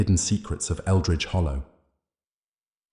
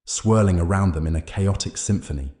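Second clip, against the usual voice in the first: ordinary delivery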